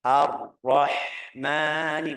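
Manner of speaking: "Ar-Rahmani" very slow, with a long airy h; correct drawn-out ha. Shown as a demonstration. Teacher modelling for learners, slow breathy ha. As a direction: stretch "Ar-Rahmani" syllable by syllable, breathing out the h. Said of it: "ucapannya yang benar".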